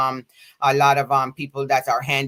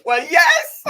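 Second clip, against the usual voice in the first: bursting out laughing, louder; laughing yes; 13 semitones higher, 8 dB louder than usual. Real, not acted. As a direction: let the laugh break through the words. Laughing recognition with guest.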